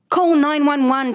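moderately projected shout to a partner; urgent shout. A narrator's reading. Father to his wife before chasing the carjacker, shouting. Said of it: "Tom shouted to Sean".

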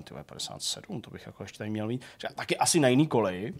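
low, very soft aside to himself; retold private reasoning, dropped in pitch and volume; muttered inner thought. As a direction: think aloud under your breath. Retells his thoughts at the station.

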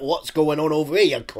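loud, hammed-up Scottish impersonation; mock Scottish accent. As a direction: put on a loud, aggressive Scot. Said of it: "someone fucking Scottish going all like".

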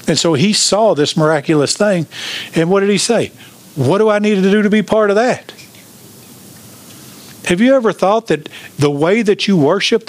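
fired-up pulpit climax; preaching at full force. Acted. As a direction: drive it home to the congregation. Climactic preaching, pitch climbing high.

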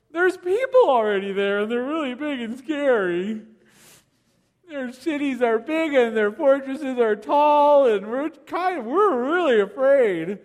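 timid complaint, half-acted; fearful spies. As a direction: play the frightened spies, lightly. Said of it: "This is the voice of fear".

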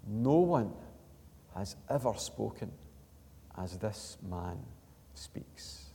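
hushed quote fading to a whisper; very simply. Retold or imitated. Temple guards answering the Pharisees, simple and hushed. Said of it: "They just said very simply".

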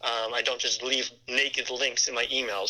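ordinary talk

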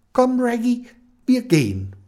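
brisk call. Acted. Kenny calls Reggie to leave the shop, brisk and loud.

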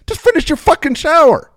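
high, loud frustrated ranting; exasperated rant. Retold or imitated. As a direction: son ranting about his mother, loud and exasperated. Speaker's own exasperated rant.

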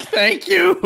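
squealed high thank-you; hammed-up high-pitched relief; gushing thanks. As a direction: gush gratefully, voice leaping way up. Character rejoicing at restored grace.

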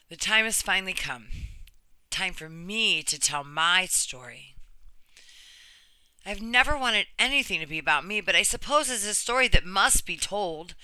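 earnest, measured reading of a personal essay; reading a story aloud. Acted. Reading a friend's brave story to viewers, earnest narration. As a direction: read it like you're giving her story a voice.

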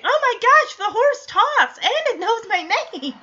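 put-on high, loud astonished gasping girl; mock amazement. Stephanie hears the horse talk, high mock amazement.

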